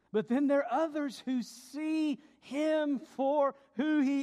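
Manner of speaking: high, loud, driving sermon climax; full-force preaching. Real, not acted. Preacher proclaiming to the congregation that Jesus is God, full-force preaching. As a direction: build to a ringing proclamation.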